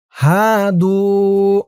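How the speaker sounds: "হাদু" with both vowels held long; slow, chanted "হাদু", one beat per long vowel; one-alif stretches. Shown as a demonstration. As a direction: draw out haa and doo in "হাদু". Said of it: "হা এক আলিফ টান".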